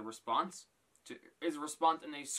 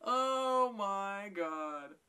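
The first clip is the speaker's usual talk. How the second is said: drawn-out oh my god; 5 semitones higher, much slower than usual. Real, not acted. Still laughing at the roast.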